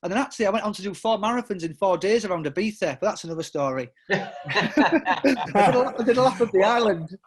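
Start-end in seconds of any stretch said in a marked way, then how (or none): 4.1-7.2 laughing. Real, not acted. Laughing about Ibiza.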